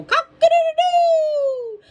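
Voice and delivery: very high, loud, drawn-out crow; long storybook cock-a-doodle-doo call; rooster crow. A narrator's reading. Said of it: "practicing his crow".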